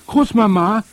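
put-on high, loud child's call; boy shouting from the tree. A narrator's reading. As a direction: call urgently down for grandmother. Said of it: "rief ich".

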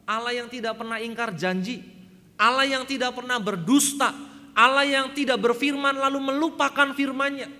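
building to a loud, high preaching climax; preaching at full force. Acted. Preacher to the congregation, swelling to a loud, high proclamation.

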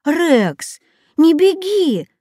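high, pleading shout after someone; calling out. A narrator's reading. Katya shouts after the running dog, calling out. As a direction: call after him, voice pitched high. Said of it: "кричала Катя".

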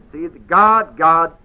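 ordinary delivery

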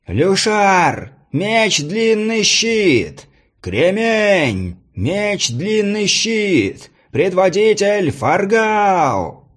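high, slow, drawn-out announcer call; herald calling out. A narrator's reading. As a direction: stretch each name out across the arena. Herald announces fighters in arena.

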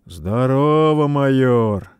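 loud, slow hearty hello; hearty greeting. Acted. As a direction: call out a big warm hello. Хэл greets the major.

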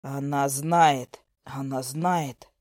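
slow, low, deliberate whisper-like. A narrator's reading.

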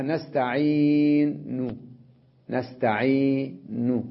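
نستعين drawn out very slowly, the final ضمة on ن faint and snatched; very slow, let نستعين end in a weak, dropping u; rawm on nastaʿīn. Shown as a demonstration. Teacher demonstrating rawm on a damma, very slow. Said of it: "هكذا اختلاس، ... هذا اسمه روم".

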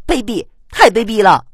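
high, loud indignant outburst; outraged cry. A narrator's reading. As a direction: yell in disgust at a dirty trick. 旁人看见偷袭，愤怒大骂. (愤怒地高声斥骂)

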